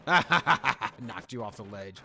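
laughing slowly at the enemy. Real, not acted. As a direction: laugh through the taunt. Laughing while playing.

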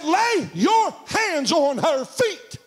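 high, slow divine command; commanding shout. Retold or imitated. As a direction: preach God's order word by word. God's command voiced in sermon.

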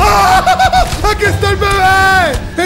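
high, loud, drawn-out mock scream; startled yell. Gamer suddenly finding the baby, yelling in mock shock. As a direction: shriek playfully as if jump-scared.

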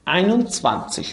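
Einundzwanzig said very slowly, syllable by syllable; German twenty-one. Shown as a demonstration. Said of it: "یعنی ein und zwanzig". (تلفظ بسیار کشیدهٔ Einundzwanzig)

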